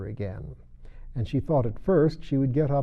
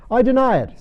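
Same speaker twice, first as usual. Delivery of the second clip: loud denial; 12 semitones higher, 9 dB louder than usual. Acted. Hare denies.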